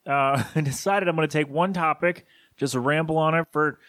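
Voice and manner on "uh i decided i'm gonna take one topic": normal talking voice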